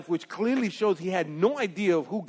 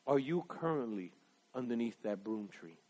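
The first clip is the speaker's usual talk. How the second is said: intimate question; 9 semitones lower, 14 dB quieter than usual. Real, not acted. Preacher's closing personal question.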